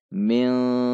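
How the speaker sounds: "Minsya" dengan n samar didengungkan panjang; ikhfa, samar dengung. Shown as a demonstration. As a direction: tahan dengung menuju sy pada "Minsya". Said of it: "Sudah siap-siap pada titik shin". (nun samar pada "Minsya")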